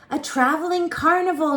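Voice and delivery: high, loud excited shout. Acted. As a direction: run up yelling the news. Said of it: "my brother shouted".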